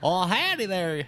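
high, slow Texan drawl; mock Texan accent. Friend echoing the howdy, mock Texan drawl. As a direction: drag out a hammed-up Texan greeting. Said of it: "Texas accent got really thick".

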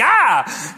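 high, loud mock scream of fright; startled yelp; startled kid yelp. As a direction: jump like a scared little boy. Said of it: "I'd be like".

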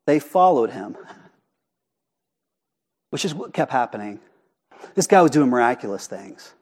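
ordinary talk

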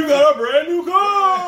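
huge, high game-show announcer shout; old-time radio announcer, loud and soaring; nineteen fifties radio guy. Acted. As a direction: belt out the big prize reveal. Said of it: "That's like a nineteen fifties radio guy".

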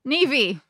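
Niamey mispronounced as NEE-vee; Niamey misread Irish-style, "neevee"; deliberate mispronunciation. Real, not acted. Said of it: "we deliberately mispronounce because it looks".

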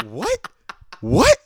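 rising, disbelieving what what; incredulous what. Real, not acted. Incredulous reaction to the verse.

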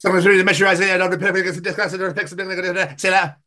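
high, rushed mock instructions; high mock babble; mock boss voice. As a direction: rattle it off like a garbled boss.

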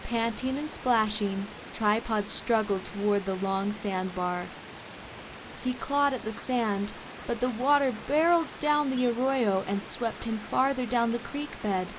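plain reading voice

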